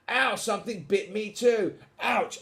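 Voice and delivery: high, startled storybook yelp; pained yelp. Acted. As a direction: yelp in mock pain as a bitten burglar. Second burglar bitten.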